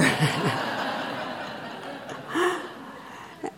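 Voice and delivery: breathy, high laugh at her own joke; a soft, airy laugh after the punchline; real laughter. Real, not acted. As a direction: let the laugh bubble out.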